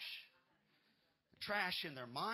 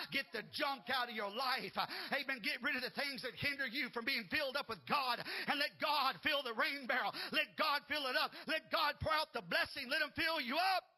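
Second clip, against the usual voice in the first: loud, high fiery preaching; preaching at full force; 6 semitones higher, 5 dB louder than usual. Acted. Pastor urging the congregation, fiery loud preaching. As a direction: rouse the church to prepare for rain. Preacher urges God to fill.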